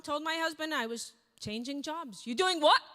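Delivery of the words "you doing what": husband's outburst. Retold or imitated. Husband reacts to her quitting.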